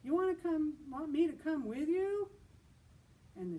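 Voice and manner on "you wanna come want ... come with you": much higher and louder, eager; called out hopefully. Acted. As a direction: call after them hoping they'll say yes. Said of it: "he called out hopefully".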